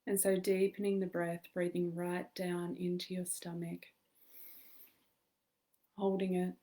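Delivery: calm meditation guide with long pauses; measured, soothing guidance in short phrases; guided meditation. Acted. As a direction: lead listeners gently into stillness, leaving space to breathe.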